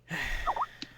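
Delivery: long breathy sigh. Acted. Game master voices a character alone after covering a friend, sighing. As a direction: let out a soft, drawn-out exhale. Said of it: "you just hear a".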